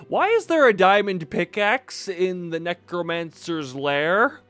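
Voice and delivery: loud disbelieving outburst; baffled and laughing in disbelief; incredulous. Real, not acted. Shocked at diamond pickaxe.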